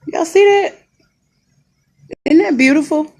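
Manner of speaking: sarcastic. Real, not acted. Mocking the view of a wall.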